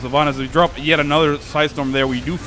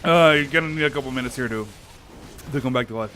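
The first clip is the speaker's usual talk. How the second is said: usual speaking voice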